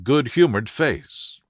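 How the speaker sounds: ordinary delivery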